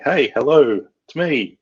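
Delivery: high, loud sing-song hello; mock phone greeting. Presenter voicing the iPhone welcome screen, high loud mock greeting. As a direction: play the iPhone saying hi to you. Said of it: "going through that out-of-box experience of going".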